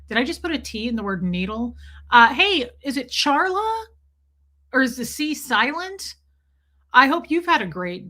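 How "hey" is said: usual speaking voice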